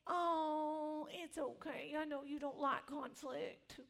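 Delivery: hammed-up tender reassurance; mock comforting. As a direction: coo it like the comfort you wanted. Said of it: "You were supposed to say".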